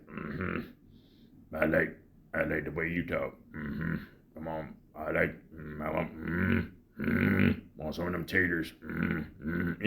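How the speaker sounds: low, soft Sling Blade mumble with little hums; Carl Childers voice. Retold or imitated. Reviewer imitating Sling Blade's Carl for his viewers, low and soft. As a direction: sink the voice low and quiet, humming between words. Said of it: "you're gonna be talking like this".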